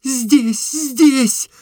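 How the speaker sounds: high, eager overlapping cry; snatch up the line, high and urgent. A narrator's reading. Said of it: "подхватила Настенька". (высоко, горячо подхватывая)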